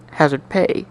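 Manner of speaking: ordinary delivery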